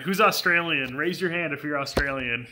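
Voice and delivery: ordinary talk, nothing marked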